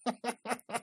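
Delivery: high, soft giggle; real breathless chuckle; quiet laughter. Real, not acted. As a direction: snicker softly, high-pitched. Laughs at the image.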